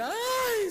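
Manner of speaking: gemido de llanto agudísimo y fuerte; llanto fingido. Retold or imitated. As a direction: suelta un ay llorón exagerado. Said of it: "se suelta a llorar más que yo". (alarido llorón de burla)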